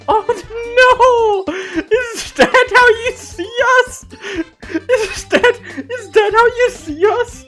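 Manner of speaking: high squealing mock horror; laughing fake dismay; mock shock. Mock outrage at German caricature.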